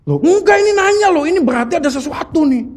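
imitating his wife pressing her point; insistent wife. Wife insisting the message means something, high and eager.

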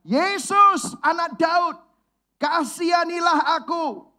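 high, loud pleading cry; a blind beggar begging for mercy, loud and pleading; beggar's desperate cry. Retold or imitated. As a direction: cry out to Jesus with all your strength. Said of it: "Men, orang ini teriak".

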